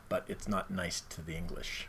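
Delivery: a hushed, unvoiced aside; whisper. Real, not acted. Host whispering a joke about the English. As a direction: whisper it so the English won't hear.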